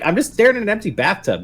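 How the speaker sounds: high-pitched incredulous player; mock exasperation. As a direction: act out a baffled player's complaint. Acts out exasperated player's reaction.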